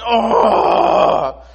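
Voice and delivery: long, loud, high mock groan; drawn-out cry of a sleepless king; frustrated wail. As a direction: wail it out in comic exasperation. Acts out sleepless king's frustration.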